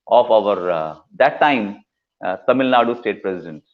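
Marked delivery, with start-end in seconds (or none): none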